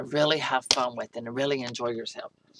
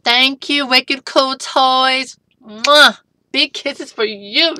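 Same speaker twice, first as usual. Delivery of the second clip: loud, high, slow gushing thanks; shouted thanks; 8 semitones higher, 12 dB louder, slower than usual. Real, not acted. Thanking the toy company, shouting loud and high. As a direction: call out thanks with big kisses.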